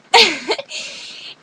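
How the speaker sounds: loud high-pitched laugh; sudden loud giggling; burst of laughter. Real, not acted. Laughs after demonstrating together as one.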